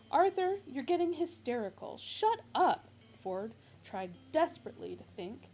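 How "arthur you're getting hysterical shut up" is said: high, loud curt order; loud, higher rebuke; sharp scolding. Acted. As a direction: cut him off hard. Ford scolding Arthur.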